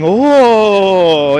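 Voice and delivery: long, loud mock chorus of shock; imitated crowd "oh". Streamer imitating everyone in the chat, mock shocked oh. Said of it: "Everyone going".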